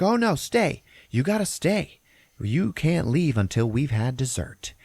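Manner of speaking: mock a drunk hostess insisting, slowing at the end; insistent drunk hostess. Drunk wife to departing guests, high insistent plea.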